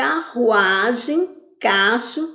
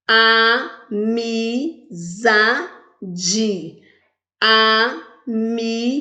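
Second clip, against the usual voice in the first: "Amizade" very slow, in four isolated beats; long pauses inside: a, mi, za, de; syllable by syllable; slower than usual. Shown as a demonstration. Teacher asking pupils to count, "Amizade" syllable by syllable. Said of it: "Quantas vezes eu falei?".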